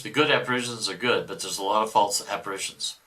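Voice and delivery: ordinary talk, nothing marked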